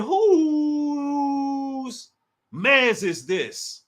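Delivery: squealed, stretched-out question; high stretched whose. Shown as a demonstration. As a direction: hold "Whose" up high, then drop. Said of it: "I said".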